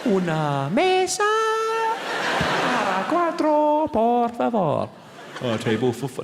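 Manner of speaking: slow, high, over-enunciated Spanish; high slow teacher Spanish; language disc voice. Retold or imitated. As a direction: drill it slowly like a tape.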